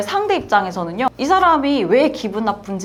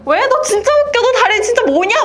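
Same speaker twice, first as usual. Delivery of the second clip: high, louder taunting voice, laughing at a friend; mocking teaser; 13 semitones higher, 5 dB louder than usual. A teasing friend mocks her legs, in a high taunting voice. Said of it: "막 이렇게 할 경우".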